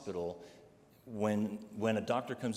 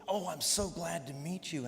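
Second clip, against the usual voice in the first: nurse's eager greeting; 7 semitones higher than usual. Retold or imitated. Morning nurse greeting him.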